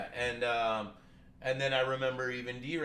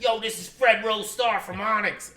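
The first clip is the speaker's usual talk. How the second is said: high, loud rapper introduction; the famous caller naming himself, loud and proud; caller's hype intro; 11 semitones higher, 13 dB louder than usual. Retold or imitated. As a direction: announce yourself like a star.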